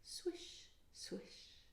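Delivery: whispered swish swish; soft swish. Acted. Leaves on the roof, a whispered swish. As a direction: barely breathe it. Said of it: "leaves fell on the roof".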